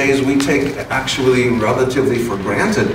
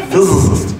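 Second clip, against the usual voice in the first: goofy word; 6 semitones higher, 3 dB louder than usual. Shown as a demonstration. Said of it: "you can't really say very seriously".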